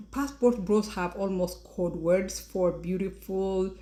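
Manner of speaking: ordinary talk, nothing marked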